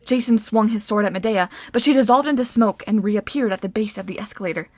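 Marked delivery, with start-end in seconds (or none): none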